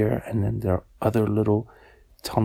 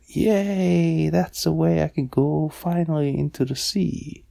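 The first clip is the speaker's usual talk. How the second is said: higher, louder mild cheer; happy cheer; 5 semitones higher, 3 dB louder than usual. Acted. Shrimp learns the way to the sea, cheering happily. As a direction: cheer with a long, high yay.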